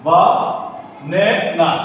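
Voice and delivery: বা, pause, then নে না; syllable by syllable. Shown as a demonstration. Teacher recapping the syllables, বা নে না broken up. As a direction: separate বা from নে and না. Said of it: "প্রত্যেকটা শব্দের অংশের সঙ্গে".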